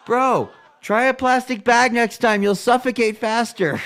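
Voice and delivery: mocking loud taunt. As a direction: sneer it loudly and slowly like a taunt. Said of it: "I'm like".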